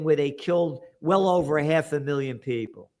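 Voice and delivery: ordinary delivery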